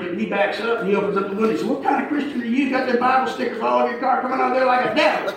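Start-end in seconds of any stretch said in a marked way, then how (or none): 1.7-5.3 a hint of an indignant stranger, higher, through laughter; mock angry driver. Driver scolding the preacher through his window, mock indignant.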